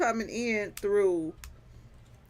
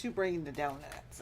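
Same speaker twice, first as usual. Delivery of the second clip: ordinary delivery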